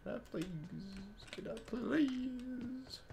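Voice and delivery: high, soft, drawn-out begging; pleading. Pretend. Gamer asking can I get up, pleading. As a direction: beg in a small high voice, stretching please.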